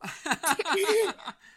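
a sudden, loud burst of laughter. Real, not acted. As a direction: let out a big, real laugh at your own joke. Host laughs after his teasing joke.

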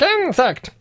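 very high bug voice; insect voice. Pretend. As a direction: pitch it way up like a bug. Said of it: "That's my insect voice".

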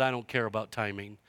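low, quiet, dismissive put-down; understated, low-voiced defiance; firm retort. Retort to the devil, retold.

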